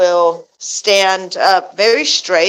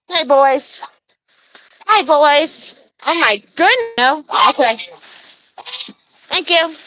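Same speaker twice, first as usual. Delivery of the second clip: high pet-talk voice; 7 semitones higher than usual. Real, not acted. Calling sweetly to the geese.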